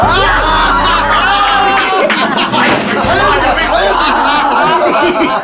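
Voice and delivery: high shouted burst of laughter; roaring long laugh. Acted. Bursts out at the joke.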